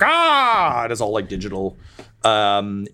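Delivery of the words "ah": sudden high yelp of pain; pained cry. Acted. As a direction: cry out as the arm is cut. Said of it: "and he's like".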